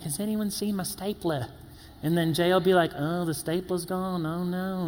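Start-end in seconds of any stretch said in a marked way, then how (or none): 0.0-1.5 softly put-on Australian accent; mock Australian accent. Coworker asking round the office for his stapler, mock Australian accent. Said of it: "weird Australian accent". 3.0-4.9 put-on soft, joking Australian accent; mock Australian accent. Coworker joining in about the lost stapler, mock Australian accent. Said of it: "weird Australian accent".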